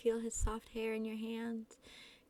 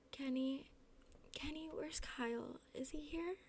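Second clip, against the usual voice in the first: higher, softer wheedling questions; pestering; 5 semitones higher, 5 dB quieter than usual. A narrator's reading. Dazed guest asks Kenny where Kyle is, pestering. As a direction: pester him in a raised, small voice. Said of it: "You pester the blonde boy".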